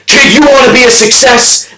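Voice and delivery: high-pitched shouted question; acted forceful demand, pitched high; intense challenge. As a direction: challenge the kid at full force. CEO challenges gasping kid.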